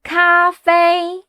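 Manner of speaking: "Cafe," both syllables high and level; drawn-out, flat high "Cafe,"; first tone. Shown as a demonstration. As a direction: hold each syllable of "Cafe," on one high note. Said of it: "words with the first tone".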